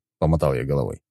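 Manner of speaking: plain reading voice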